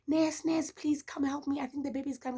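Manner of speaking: high, pleading cry for the nurse; desperate plea. Retold or imitated. Mother in labor begging the nurse, high desperate plea. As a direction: beg the nurse for help, voice pitched high. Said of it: "when you ask for the nurse's help".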